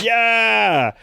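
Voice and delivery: long, high drawn-out cheer; stretched high hurrah; imitated crowd cheer. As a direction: cheer like a whole arena. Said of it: "everyone's like".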